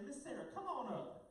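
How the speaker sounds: loud, high call up to the front; big, high-pitched summons. Shown as a demonstration. Leading the crowd to call Sarah up.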